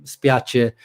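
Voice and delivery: "spiace" as spee-AH-cheh with a falling end; Italian pronunciation. Shown as a demonstration. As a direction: give "spiace" its Italian ch sound. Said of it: "in Italian".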